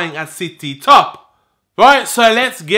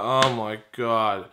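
low, slow, soft groan of dismay; retold deflated resignation; defeated; 10 semitones lower, 9 dB quieter, much slower than usual. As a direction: sink down low and quiet, drawing it out. Retold dismay at being caught.

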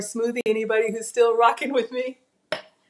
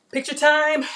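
high, loud sing-song call; bright call to the camera; excited call; 6 semitones higher, 6 dB louder than usual. Real, not acted. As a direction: announce it like a fun event. Announcing photo time, sing-song.

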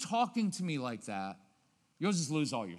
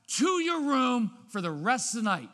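loud, higher order; louder banishing order; angry banishment; 7 semitones higher, 7 dB louder than usual. Shown as a demonstration. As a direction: send the kid away in a temper. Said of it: "Watch what happens".

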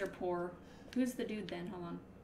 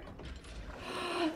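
sharp unvoiced gasp of fright; startled gasp; 8 semitones higher, 7 dB quieter than usual. Real, not acted. Sudden scare in game.

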